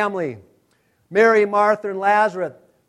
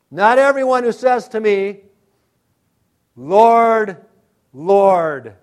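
emphatic quote; 4 semitones higher, 10 dB louder, slower than usual. Acted. Quoting Jesus with emphasis.

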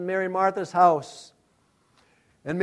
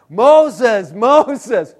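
booming call from the burning bush, higher and louder; 6 semitones higher, 12 dB louder than usual. Acted. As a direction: summon him by name. God calling from the bush.

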